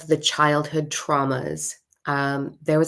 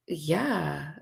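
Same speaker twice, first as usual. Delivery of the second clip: soft, drawn-out, unsure yes; hesitant; 6 dB quieter than usual. Retold or imitated. Woman answering the spirit boy, hesitant. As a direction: answer softly, not quite believing it.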